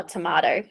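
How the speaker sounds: tomato with a broad "mah" and soft d, tuh-MAH-doh; Australian tomato. Shown as a demonstration. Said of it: "answering the word game".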